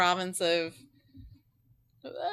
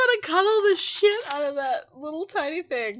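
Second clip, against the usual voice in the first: overwhelmed by cuteness; 11 semitones higher, 4 dB louder than usual. Real, not acted. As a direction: coo at a tiny pet. Gushing over animal photo.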